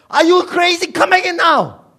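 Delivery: high, very loud alarmed scream; screaming parent. Acted. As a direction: scream at children running into danger. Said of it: "you'll be screaming if you see your children".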